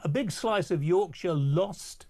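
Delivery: ordinary talk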